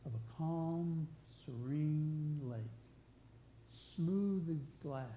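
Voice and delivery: very slow, stretched-out words; serene, drawn out. Real, not acted. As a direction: linger on each word to paint still water. Painting a picture of calm water.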